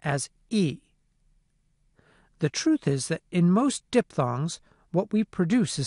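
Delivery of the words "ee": "e" said as long "ee"; isolated "ee" sound for "e"; high front vowel demo. Shown as a demonstration. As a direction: say "e" as a pure "ee". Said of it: "the last part of the diphthong".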